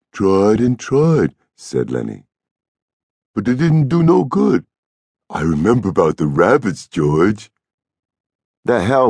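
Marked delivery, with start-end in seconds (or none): 0.1-1.4 put-on higher, slow childlike protest; sorry Lennie. A narrator's reading. Lennie excusing his forgetting, plaintive. 3.3-7.5 put-on simple, childlike voice, starting high; plead, then offer it hopefully; plaintive Lennie. A narrator's reading. Lennie telling George about the rabbits, hopeful.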